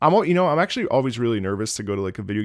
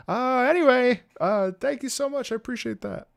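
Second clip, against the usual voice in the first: high-pitched polite sign-off; imitated grateful thanks; 12 semitones higher than usual. Streamer wraps up with a fan, high polite thanks.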